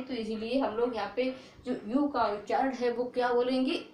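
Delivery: ordinary talk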